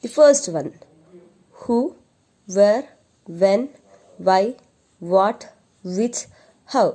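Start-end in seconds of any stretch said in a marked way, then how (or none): 1.6-6.9 reading list aloud. Shown as a demonstration. Teacher reading the question words for pupils to repeat, emphatic. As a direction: list read out word by word, emphatic. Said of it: "Read it once again".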